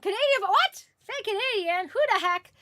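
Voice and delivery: high, loud incredulous outburst; playful disbelief. Real, not acted. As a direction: exclaim it in mock shock. Mock disbelief at chat.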